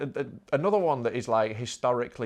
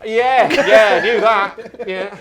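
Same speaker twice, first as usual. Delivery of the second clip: sheepish; 8 semitones higher, 13 dB louder, much slower than usual. Retold or imitated. Sheepish reply to fireman.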